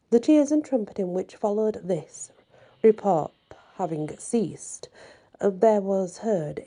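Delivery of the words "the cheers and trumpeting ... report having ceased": ordinary delivery, nothing marked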